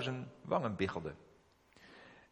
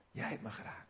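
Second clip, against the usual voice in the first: whispered, much softer, choked with tears; tearful whisper; 2 semitones lower, 11 dB quieter than usual. Retold or imitated. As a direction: breathe it out as if about to cry. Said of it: "En toen begon hij te huilen".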